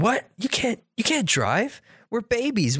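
high-pitched, incredulous cartoon babies; mock shocked protest. Babies objecting to Angelica driving the car, mock shock. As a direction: squeak in disbelief, imitating alarmed tots.

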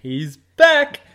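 high, loud happy cheer; excited shout. Real, not acted. Greeting his returning cohost, an excited shout. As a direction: whoop him back on air.

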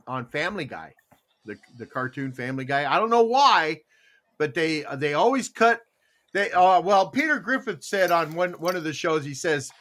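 ordinary talk, nothing marked

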